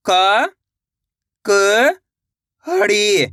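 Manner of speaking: isolated, held sounds with long pauses; teacher slowly spelling out by sound; syllable by syllable. Shown as a demonstration. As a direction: sound out each syllable separately for learners. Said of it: "एक अक्षर का उच्चारण करके".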